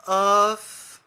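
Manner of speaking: crowd-pumping yell; loud stage cry. Acted. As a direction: belt out a loud hype cry. Performer hypes crowd.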